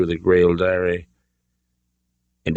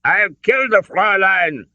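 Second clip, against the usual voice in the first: put-on high, forceful German-accented threat; spat through clenched teeth; 13 semitones higher, 4 dB louder than usual. A narrator's reading. Colonel threatens to shoot his hostage, spitting fury. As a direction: spit the threat out. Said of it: "spat through his clenched teeth".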